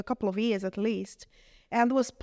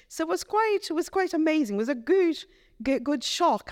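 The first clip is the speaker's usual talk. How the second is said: delighted; 8 semitones higher than usual. Real, not acted. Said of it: "quite a shock".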